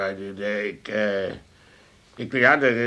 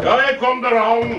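calling out; 6 semitones higher, 4 dB louder than usual. Acted. Calling to someone away off.

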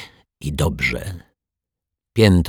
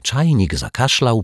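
ordinary delivery, nothing marked

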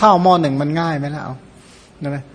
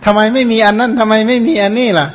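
put-on higher, whining kid complaining about dinner; whiny child; 5 semitones higher, 3 dB louder than usual. Child grumbling to mother about the food, higher whining voice. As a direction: mimic a spoiled child grumbling at the table. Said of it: "บ่นอีกนะว่ากับข้าวไม่อร่อย".

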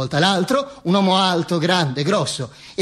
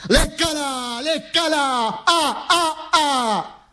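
high, drawn-out jeering chant; crowd egging on; 9 semitones higher, much slower than usual. Acted. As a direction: goad him like a rowdy crowd, long high vowels. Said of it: "mi incita". (incitamento da folla, acuto e strascicato)

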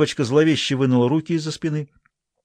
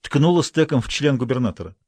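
usual speaking voice

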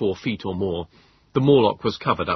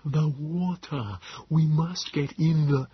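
Nebogipfel; 7 semitones higher, slower than usual. A narrator's reading. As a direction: drag the words out with failing breath. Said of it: "syllables were barely distinguishable".

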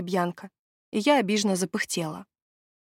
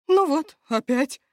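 a high, loud groan of exasperation; 6 semitones higher, 5 dB louder, slower than usual. Acted. Steward groaning over the dead lord again. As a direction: moan it out, fed up with the trouble. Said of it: "простонал Лоутрок".

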